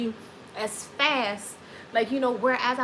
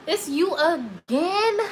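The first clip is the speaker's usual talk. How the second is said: loud, high, drawn-out incredulous question; exasperated phone greeting, pitched up and loud; mock exasperation; 6 semitones higher, 6 dB louder, much slower than usual. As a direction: stretch it out like you dread this caller. Acted answer to constant caller.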